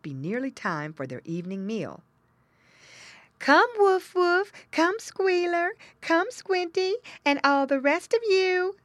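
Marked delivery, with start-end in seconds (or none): 3.4-8.8 very high, slow, loud call; mother pig calling. A narrator's reading. Mother pig calls her young to supper, calling out. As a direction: sing out to children far off. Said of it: "called Missus Pig in her grunting voice".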